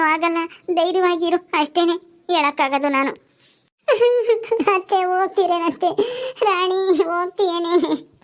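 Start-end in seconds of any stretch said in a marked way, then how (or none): 3.9-4.7 high choked sob; a sudden high wail of crying. Acted. Jailed niece sobbing as aunt leaves. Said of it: "ಅಳ್ಬೇಡ ಸುಮ್ನಿರು ಶಿಲ್ಪ". 4.8-8.2 crying plea. Acted. Jailed niece crying as aunt turns to leave. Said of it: "ಅಳ್ಬೇಡ ಸುಮ್ನಿರು ಶಿಲ್ಪ".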